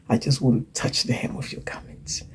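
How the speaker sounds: hushed, unvoiced whisper of longing; whispered plea. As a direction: breathe it like a desperate woman reaching out. Woman touching the hem.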